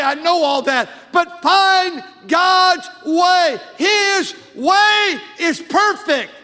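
loud, high, drawn-out preacher's proclamation; emphatic preaching. Real, not acted. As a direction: preach it hard, stretching the final phrases. Preaches at full force.

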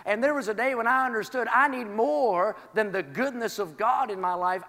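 ordinary talk

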